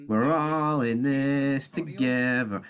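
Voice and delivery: slow, drawn-out sarcastic parody of a fan; hint of a sappy fan chant; mock tourist fan. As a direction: lightly mimic a naive glory hunter. Mocking tourist fans' slogan.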